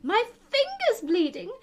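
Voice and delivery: high, loud panicked Queen; shouted alarm. A narrator's reading. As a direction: cry out shrilly in alarm. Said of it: "shouted the Queen".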